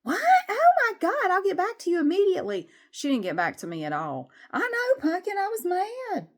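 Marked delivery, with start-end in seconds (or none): 0.0-2.7 very high, loud, fast mock squeal; fake-shocked office lady. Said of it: "office lady reacts, shocked". 4.5-6.3 very high pretend pet voice; cat voice. Voicing the cat replying.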